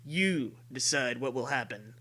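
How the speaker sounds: normal talking voice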